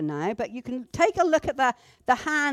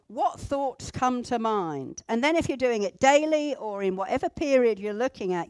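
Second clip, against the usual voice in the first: usual speaking voice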